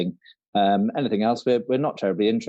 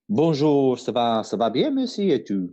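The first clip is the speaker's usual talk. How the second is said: playful mock French; hammed-up schoolroom French; French accent. Co-host showing the host how, playful French accent. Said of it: "do it in French".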